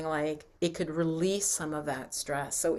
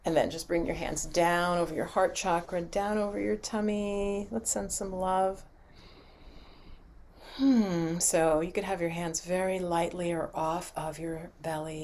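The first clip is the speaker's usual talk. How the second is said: guided relaxation; 3 semitones lower, 5 dB quieter than usual. Acted. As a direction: gently talk them down through the body. Leads a body relaxation.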